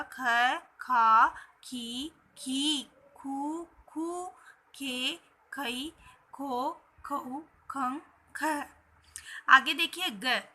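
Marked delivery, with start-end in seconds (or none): 0.0-8.6 "ख" series drawn out slowly, one syllable at a time; syllable recitation. Shown as a demonstration. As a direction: recite "ख" through "खह" slowly as a measured drill. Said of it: "पढ़ेंगे तो ये बन जाएगा". ("ख" से "खह" तक धीरे-धीरे खींचकर उच्चारण)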